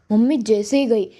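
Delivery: ordinary delivery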